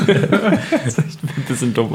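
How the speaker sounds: says it through laughter, slowly; laughing. Real, not acted. Friend laughing at the fake excuse joke. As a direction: chuckle the words out.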